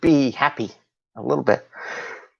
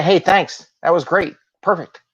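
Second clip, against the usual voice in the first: bright, higher-pitched thanks; bit of energy; 6 semitones higher than usual. Shown as a demonstration. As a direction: thank them with peppy enthusiasm. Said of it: "having that little bit of energy".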